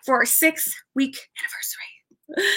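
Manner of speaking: soft voiceless whisper; whispered aside. Real, not acted. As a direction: drop to a whisper as a little aside. Aside between award announcements.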